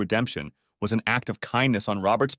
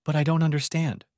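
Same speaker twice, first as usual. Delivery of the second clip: pitiful; 6 semitones higher than usual. A narrator's reading. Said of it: "she said pitifully".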